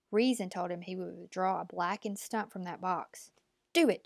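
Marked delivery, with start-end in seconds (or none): 3.7-4.1 higher and louder, a curt snap; the old woman snapping at the boy to show his hand; snapped. A narrator's reading. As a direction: bite the order off impatiently. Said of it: "she snapped".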